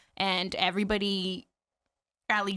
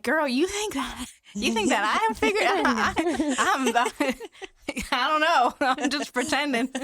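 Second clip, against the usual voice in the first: high, loud, laughing confession; a laughing girl, you think I've got it figured out; 7 semitones higher, 5 dB louder than usual. Retold or imitated. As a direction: laugh it out, high and loud, admitting you're faking. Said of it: "And I'm like".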